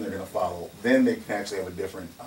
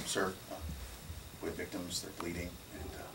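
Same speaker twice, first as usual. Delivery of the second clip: meek polite request; 5 semitones lower, 17 dB quieter, faster than usual. Retold or imitated. Deferential request to officer.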